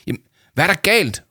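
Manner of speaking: high, loud worried call; worried shout. Acted. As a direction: call out to him in alarm. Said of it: "råbte Victoria bekymret".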